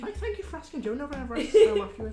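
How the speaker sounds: high, loud, laughing complaint; laughing mock hurt. Real, not acted. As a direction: pretend offence through laughter. Mock self-pity, laughing.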